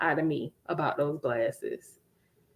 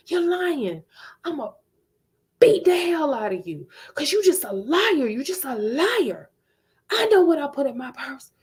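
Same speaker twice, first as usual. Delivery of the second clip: high threatening mother voice; accusing mom; 6 semitones higher than usual. Retold or imitated. Mother accusing her daughter of lying, high and threatening. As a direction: voice the mother calling her a liar.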